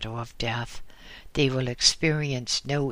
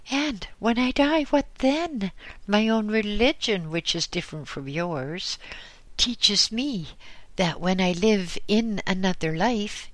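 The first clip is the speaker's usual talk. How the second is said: put-on distressed old woman, pitched up, lamenting; old woman's voice; 7 semitones higher than usual. Old woman to the Lama, lightly voiced lament. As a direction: pour out her fear of living and dying.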